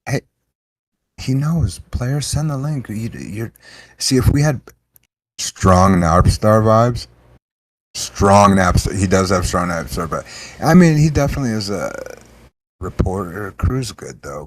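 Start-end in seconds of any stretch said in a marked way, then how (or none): 5.4-9.0 slow, loud, drawn-out call; drawn-out hype. Real, not acted. Hyping the guest on the call, loud and slow. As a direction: stretch it out like a hype man.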